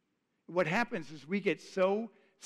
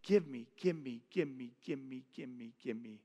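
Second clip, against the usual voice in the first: repeated pleading that fades from normal to much softer; mock greedy begging; 5 semitones lower, 4 dB quieter than usual. Preacher imitating selfish prayers, fading softer. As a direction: mimic a needy prayer dying away to a murmur. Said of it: "We come to God and we say".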